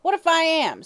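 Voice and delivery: put-on old hag snarls at the detective; snarling hag. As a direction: snap it back defiantly. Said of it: "snarled the woman".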